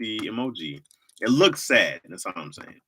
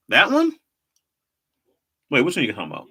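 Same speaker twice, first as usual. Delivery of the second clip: normal talking voice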